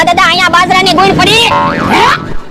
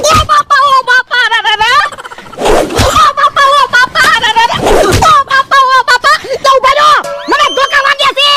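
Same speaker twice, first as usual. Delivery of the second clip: pained cries; 5 semitones higher than usual. Acted. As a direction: cry out as each blow lands. Beaten with sticks, crying out. (ધોકા વાગતાં ઊંચા, જોરથી દર્દભર્યા બરાડા)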